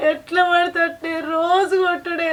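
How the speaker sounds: slow, dragged-out lament, stress on రోజు; wife telling elders of daily beatings, slowly; slow bitter complaint. Acted. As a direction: draw the words out with hurt.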